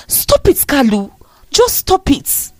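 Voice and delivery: high, pleading firm protest through tears; firm, tearful anger. A narrator's reading. Bride to estranged husband, firm and tearful. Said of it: "her voice more firm now".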